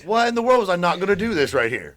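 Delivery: high, booming mock disbelief; incredulous exclamation. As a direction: belt it out, sky-high and astonished. Acting elated disbelief mid-workout.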